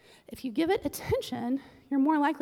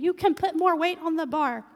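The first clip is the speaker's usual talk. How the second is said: mock coach yell; 4 semitones higher, 6 dB louder than usual. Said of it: "our coach who's yelling at us".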